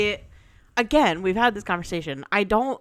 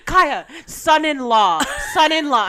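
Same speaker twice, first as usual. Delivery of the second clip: slow, higher and louder, pressing the point; insistent mock shout; 8 semitones higher, 7 dB louder than usual. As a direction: hammer it home to your friend. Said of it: "She goes".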